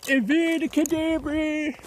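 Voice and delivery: high, slow sing-song spell; wizard incantation. Pretend. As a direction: cast it slowly like a wizard. Another spell.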